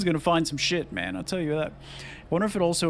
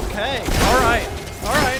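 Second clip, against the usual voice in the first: relieved excitement; 7 semitones higher, 6 dB louder than usual. Real, not acted. Just survived a terrifying wave.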